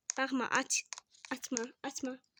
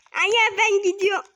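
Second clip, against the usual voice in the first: higher, louder call to mom; 7 semitones higher, 8 dB louder than usual. Real, not acted. Calling out to mom.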